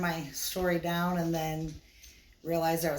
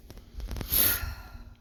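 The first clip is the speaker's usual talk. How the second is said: breathy sigh; 5 dB quieter than usual. Acted. Tense moment, roll arrives.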